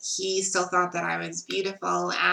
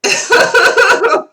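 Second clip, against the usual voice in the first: a high, loud burst of laughter; 13 dB louder than usual. Real, not acted. Telling viewers they're still talking, a loud giddy laugh.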